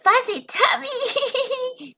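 high, slow giggly words; giggling. Acted. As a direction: giggle through it. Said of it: "she giggled".

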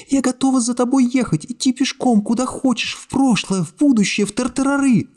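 high, sobbing-tinged murmur of love; high tearful young woman. A narrator's reading. Secretary tearfully clinging to the hero, high and weepy. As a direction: voice her high, choking back tears. Narrator voices Iskra.

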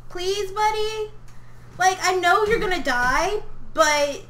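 high, pleading wheedle; nervous coaxing. Real, not acted. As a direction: coax the bug out in a high voice. Coaxing the bug to come out.

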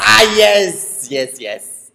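loud gloating yes with the pitch up; triumphant yes. Real, not acted. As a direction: let out a victorious cheer. Triumphant exclamation.

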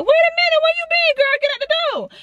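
high, sassy scolding squeal at the cat; mock scolding. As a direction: shoo the cat out in a shrill high voice.

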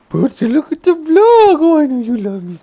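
high, loud pet-talk voice. Real, not acted. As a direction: gush at the pet. Talking excitedly to pet Percy.

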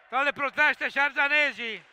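ordinary talk

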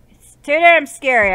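high, loud scared complaint; frightened gamer yelling in fear; scared outburst. Real, not acted. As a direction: cry it out, genuinely spooked.

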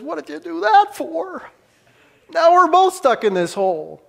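what on earth did you do, incredulous; exasperated. Acted. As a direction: friend protests the jump in shock. Bob scolds friend who jumped in.

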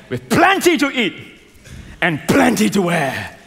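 loud, high rallying cry; climactic preaching shout. Real, not acted. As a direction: belt out each blessing to the crowd. Sermon climax.

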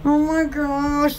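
ordinary talk, nothing marked

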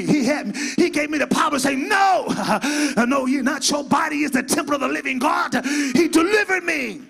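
loud, fast, shouted testimony; preaching at full force. Acted. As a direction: preach it out to the congregation.

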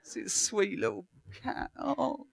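high, soft, cooing over a cute cat; mock cooing over a cat. Preacher imitating the men in the room, cooing high and soft. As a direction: gush softly like someone seeing a kitten. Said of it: "Even you men in the room went".

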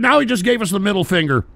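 ordinary talk, nothing marked

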